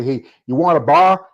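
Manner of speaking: mock Southerner offering a drink, "bar" rhymes with car; southern beer. Host imitating Southern neighbors offering beer, mock drawled "bar". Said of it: "when somebody say".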